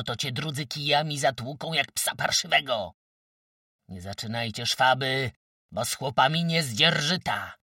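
loud taunting dare, bursting into shouts; brazen, defiant threats. A narrator's reading. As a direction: dare them to lay a hand on you. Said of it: "grozili zuchwale i wyzywająco".

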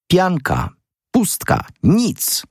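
very high single words, each set apart; excited exposing. A narrator's reading. Exposing the statues as worthless fakes. (podekscytowane wykrzykiwanie)

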